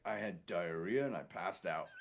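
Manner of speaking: low, soft imitation of a sick man; drained sick man. Poisoned man reporting over the phone, drained mock voice. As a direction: drop low and quiet, imitating someone wiped out.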